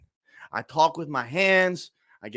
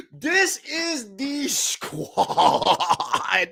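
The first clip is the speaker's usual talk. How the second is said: laughing; 5 semitones higher, much slower than usual. Real, not acted. Overwhelmed fan laughing, tearful.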